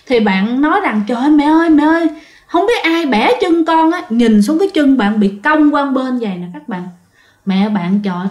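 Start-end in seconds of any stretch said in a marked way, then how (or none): 1.1-4.0 higher-pitched mock wail to mom; retold cry of pain. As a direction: call out to mother in pain, lightly acted. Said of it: "bạn la quá trời". (giả giọng la đau gọi mẹ)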